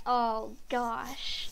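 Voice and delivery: ordinary talk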